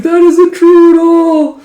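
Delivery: high, very slow squeal of denial; squeaky high outburst. Acted. Recapper voicing Parya, squealing high and slow in denial. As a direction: shriek it out in slow flustered disbelief.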